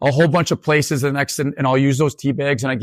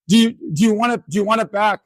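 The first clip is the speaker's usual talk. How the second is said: retold student, voiced high; pleading young student, a little higher; imitating a distressed student in class; upset student; 8 semitones higher than usual. Student offering the sign back.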